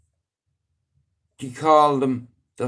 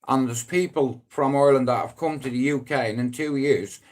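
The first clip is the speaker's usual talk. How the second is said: usual speaking voice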